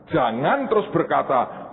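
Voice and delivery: ordinary talk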